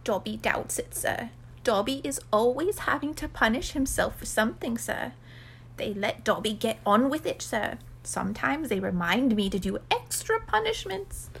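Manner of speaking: small servile creature, meekly explaining; meek elf recounting punishments; resigned house elf. Acted. Plays Dobby describing his punishments.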